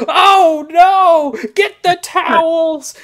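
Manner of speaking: high, loud panicked yell; panicked shout. Retold or imitated. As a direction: shout for the towels, alarmed. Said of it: "I'm like".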